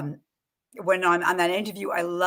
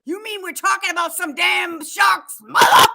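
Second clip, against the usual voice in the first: loud, high shouting Samuel Jackson; Samuel Jackson impression; 11 semitones higher, 8 dB louder than usual. Imitating Samuel Jackson for the co-host, shouting and high. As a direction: yell it like an outraged Samuel Jackson. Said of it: "like Samuel Jackson".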